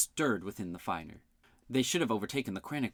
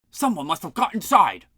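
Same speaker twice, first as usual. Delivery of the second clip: panting, louder and higher; breathless gasping words; out of breath; 7 semitones higher, 9 dB louder than usual. A narrator's reading. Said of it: "panted Fergic".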